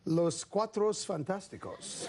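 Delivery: slow, rolled Spanish pronunciation of Los Cuatros Fantásticos; Spanish accent. Retold or imitated. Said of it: "or as they call us".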